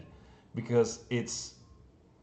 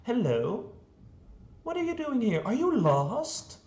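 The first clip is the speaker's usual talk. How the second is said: much higher, gentle sing-song, slowing at the end; mock-friendly villain talking to a little girl; overly sweet greeting; 11 semitones higher than usual. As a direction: greet the lost child far too kindly. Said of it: "No, he's like".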